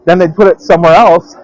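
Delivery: usual speaking voice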